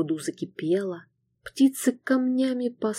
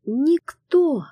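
frightened cry; 7 semitones higher, 5 dB louder than usual. A narrator's reading. Terrified girl under pressure.

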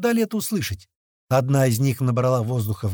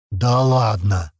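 slow, lower-pitched disbelief; blurted disbelief; 4 semitones lower, 4 dB louder, much slower than usual. A narrator's reading. Man hearing a monkey talk, blurted disbelief. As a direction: drag it out, stunned. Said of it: "выпалил Слива".

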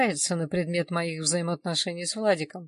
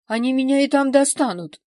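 raised, much louder protest; indignant; 7 semitones higher, 11 dB louder than usual. A narrator's reading. Injured man afraid of being found in hospital, indignant. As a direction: push back loudly, high and outraged. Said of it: "возмутился Владик".

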